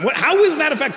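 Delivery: high-pitched incredulous son; mock-exasperated high question; exasperated child. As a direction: push back like a baffled kid. Child argues back at dad.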